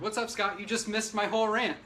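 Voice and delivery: ordinary delivery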